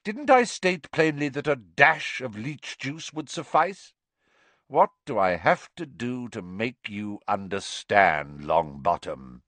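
stern, scolding potions master; exasperated Snape. Acted. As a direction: berate a hopeless pupil, hitting key words hard.

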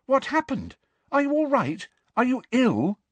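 high, anxious questioning; urgent, alarmed concern in a high voice; frightened. A narrator's reading. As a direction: fire off worried questions, pitched high. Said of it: "looking very frightened".

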